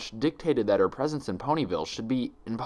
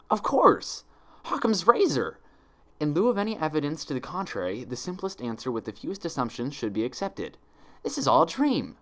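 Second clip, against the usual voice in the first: excited realization; 7 semitones higher than usual. A narrator's reading. Twilight solving her puzzle, high excited eureka. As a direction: burst out with the answer. Sudden relieved insight.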